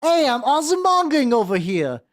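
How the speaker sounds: hammed-up Brooklyn accent, pitched high. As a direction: call it out like a cartoon New Yorker from Brooklyn. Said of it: "still love fucking Brooklyn, Osaka".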